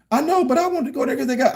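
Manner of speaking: lightly voiced pleading wife.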